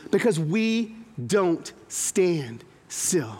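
drawn-out, quiet and deliberate; slow each word right down to drive it home; quiet, dragged-out sermon point; slow, heavy emphasis. Acted.

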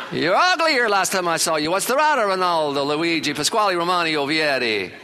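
high, loud mock Italian uncle teasing; loud Italian relatives. Italian relatives loudly mocking little Ronnie's nose, hammed-up. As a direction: tease the boy like a yelling Italian relative. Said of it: "they just tell you".

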